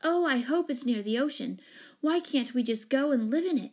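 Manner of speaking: high, loud, eager pleading; bubbling over with hope; excited cry. A narrator's reading. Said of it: "cried Jane".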